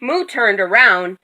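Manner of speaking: ordinary delivery, nothing marked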